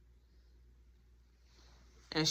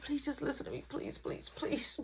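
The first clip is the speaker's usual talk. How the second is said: breathy whispered pleading; the son begging mom upstairs in a hushed whisper; 8 semitones higher, 10 dB quieter than usual. Retold or imitated.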